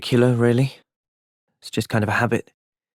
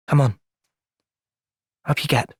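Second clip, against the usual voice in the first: ordinary delivery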